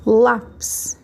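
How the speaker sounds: "Lápis" with "Lá" held and punched; stress on first syllable. Shown as a demonstration. Teacher repeating an example word for pupils, stressed "Lá" in "Lápis". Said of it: "a sílaba mais forte é o lá".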